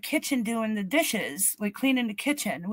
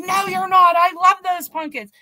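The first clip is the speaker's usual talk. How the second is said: higher, louder protest; insistent mother; 7 semitones higher, 6 dB louder than usual. Retold or imitated. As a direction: voice a mother stubbornly refusing. Mother refusing in retold argument.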